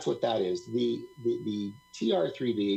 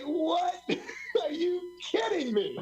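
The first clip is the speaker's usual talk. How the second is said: stunned disbelief; slower than usual. Retold or imitated. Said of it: "I'm like".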